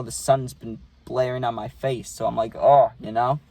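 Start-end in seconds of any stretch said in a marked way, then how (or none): 2.6-2.9 loud pained groan. Retold or imitated. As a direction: let it burst out like the sun hurts. Reacting to blaring sun.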